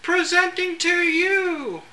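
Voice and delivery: high, loud, slow proclamation; town crier. Acted. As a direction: announce it like a herald boy. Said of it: "Kids get the flair for the dramatic".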